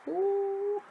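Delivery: high, loud mock-dramatic ooh; a shrill dramatic groan at the memory. Recalling freshers' flu.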